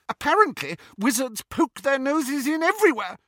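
high, loud sneer; mock wizards with scorn; sneering sister. A narrator's reading. Petunia sneering at wizards to Lily, high and loud.

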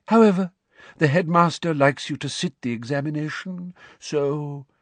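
high, dreamy disdain fading at the end; trailing away delicately. A narrator's reading. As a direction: start high, then let it drift off delicately. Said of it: "Her voice trailed away delicately".

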